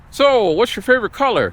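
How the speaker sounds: high, bright-eyed customer service lilt; hammed-up call-center question; mock operator voice. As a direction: chirp it like a scripted phone rep. Mocking the security question asker.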